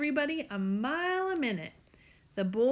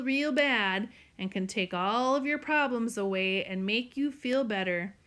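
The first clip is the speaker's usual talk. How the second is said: usual speaking voice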